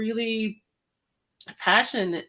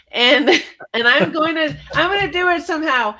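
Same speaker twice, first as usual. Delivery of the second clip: loud, high and fast; fired up; 7 semitones higher, 6 dB louder than usual. Real, not acted. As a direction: burst out with excitement. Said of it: "I get really fired up".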